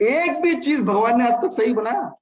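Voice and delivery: aggrieved man raising his voice at his friend; indignant outburst. Acted. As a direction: throw the grievance out hard. Man complaining that God erred.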